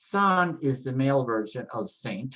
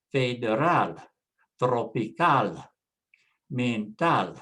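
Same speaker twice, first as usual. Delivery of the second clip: federal, tropical, mental each stressed on the final AL; Spanish vowels, each word landing on its last syllable. Shown as a demonstration. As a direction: say federal, tropical, mental as feh-deh-RAL, tro-pee-CAL, men-TAL. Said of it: "stress on the last syllable".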